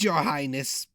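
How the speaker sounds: croaking handmaiden, queasy; a hint of a croaking maid. A narrator's reading. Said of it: "had a croaking voice".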